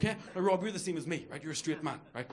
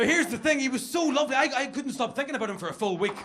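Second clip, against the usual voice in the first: ordinary delivery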